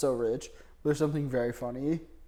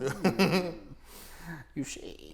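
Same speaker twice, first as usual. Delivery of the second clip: evil Elon voice; 4 semitones higher, much slower than usual. Pretend. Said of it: "Fucking evil Elon voice".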